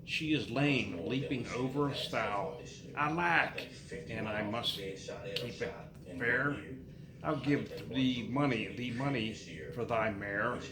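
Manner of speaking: ordinary talk, nothing marked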